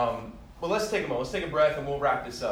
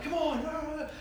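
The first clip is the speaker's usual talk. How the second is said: 1 mock sideline yell; 13 semitones higher, slower than usual. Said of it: "I'm out there like".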